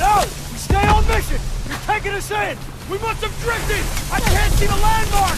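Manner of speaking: high, strained orders; urgent shouting. Acted. Lieutenant overrules the pilot, high urgent shouting. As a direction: yell over the engines. Lieutenant argues under fire.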